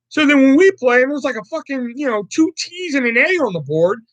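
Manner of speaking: usual speaking voice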